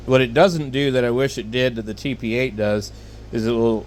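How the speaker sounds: ordinary delivery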